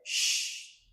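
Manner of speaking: whispered sh, soft and hushing; a quiet hissed sh; the sh sound. Shown as a demonstration. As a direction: breathe out a clean sh. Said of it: "Kòman pwononse se H la".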